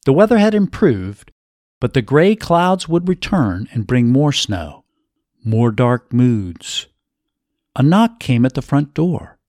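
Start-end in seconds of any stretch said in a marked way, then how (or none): none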